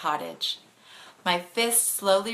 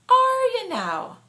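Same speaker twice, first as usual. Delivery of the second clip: high, slow teasing question; Flynn's teasing voice; 6 dB louder, slower than usual. Acted. The driver teases the girl from LA, high and slow. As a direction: drawl it out, high and amused.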